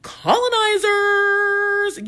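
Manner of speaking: drawn-out, high, loud call like a teacher leading a chant; class call-out answer. Shown as a demonstration. Teacher leading the class in a long, loud, high chant. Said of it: "Say it with me, class".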